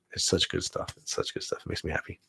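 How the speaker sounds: soft unvoiced whisper; a whispered guilty pleasure; whispered delight. Real, not acted. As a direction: savor it in a whisper. Gushing about the show.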